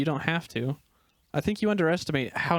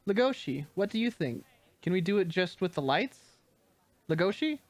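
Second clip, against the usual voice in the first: higher, searching call; 4 semitones higher than usual. Acted. Stage director calls for missing Legoshi. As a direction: look around for him.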